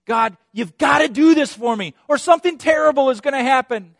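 high, loud frantic demand to God; demanding prayer. Acted. A believer demanding God act, loud and frantic. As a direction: insist on it, panicked.

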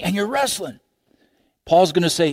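ordinary delivery

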